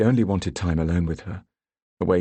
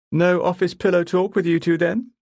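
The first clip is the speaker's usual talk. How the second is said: mocking dig; 12 semitones higher, 5 dB louder than usual.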